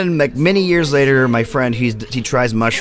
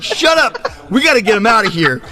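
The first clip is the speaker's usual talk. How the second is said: hurried, hammed-up friends; mock yell; 9 semitones higher than usual. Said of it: "they're like".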